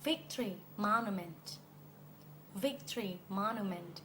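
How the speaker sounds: victory monument as VIC-tree MON-ument; front-stressed victory monument. Shown as a demonstration. Teacher models the landmark's name, victory monument as VIC-tree MON-ument. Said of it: "ว่าเขาออกเสียงแบบนี้หรือเปล่า".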